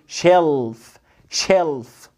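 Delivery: low, falling "شيلف" with a dark L. Shown as a demonstration. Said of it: "انطقها ال".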